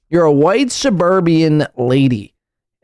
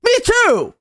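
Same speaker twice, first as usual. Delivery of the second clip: hammed-up excitement; mock giddy shout; 14 semitones higher than usual. Host mocks streamer's joy with giddy shrieks.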